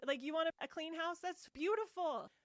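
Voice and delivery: high, bright-eyed mock approval; playful exaggerated praise; mock delight. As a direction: gush over it like it's a gift.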